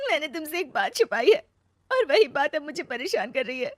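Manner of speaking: high, tearful confession; weepy, high-pitched voice; crying. Acted. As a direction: confess through acted sobs. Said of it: "रो क्यों रही हो".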